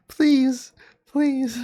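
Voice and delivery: high, loud mock-sobbing pleas; imitating crying. Player mocking Larry's crying, in a high, loud mock sob. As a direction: whine it like a blubbering friend. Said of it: "This guy's just crying".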